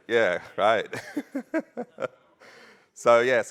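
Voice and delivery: ordinary talk, nothing marked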